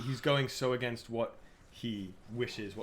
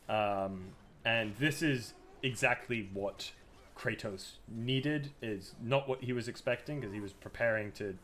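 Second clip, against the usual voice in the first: ordinary delivery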